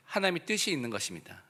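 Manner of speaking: normal talking voice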